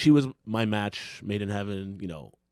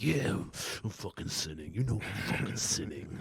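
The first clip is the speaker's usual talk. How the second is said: lightly gravelled wrestling promo growl; low, quiet wrestler promo voice; Macho Man Randy Savage; 4 semitones lower, 10 dB quieter than usual. Retold or imitated. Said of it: "you sound like Macho Man Randy Savage cutting a promo".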